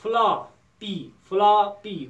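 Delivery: "فلا، پی" with a clear gap between the halves; split into syllables. Shown as a demonstration. Teacher dividing floppy for pupils, "فلا، پی" loud and separated. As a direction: punch out "فلا", pause, then "پی". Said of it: "فلا چھِ اَکھ سلیبل".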